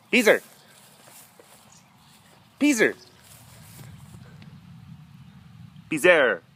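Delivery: high call to the cat. Real, not acted. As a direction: call the cat over from afar. Calling the cat by name.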